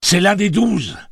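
high, loud cry of recognition; exclamation criée. A narrator's reading. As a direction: call it out as a sudden realisation. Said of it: "s'écria-t-il". (s'écrier d'une voix forte)

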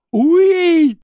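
very high, very loud scream; screamed yes. A narrator's reading. Jake screaming at Tic-Tac in pain. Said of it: "hurla Jake".

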